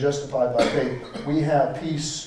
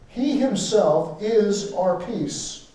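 slow, "he" stressed and raised; emphatic he himself; 4 semitones higher, much slower than usual. Shown as a demonstration. Preacher reading the verse to the congregation with emphatic stress on "he". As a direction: lean hard on "he himself". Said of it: "it's emphatic".

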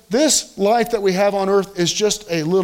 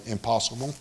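usual speaking voice

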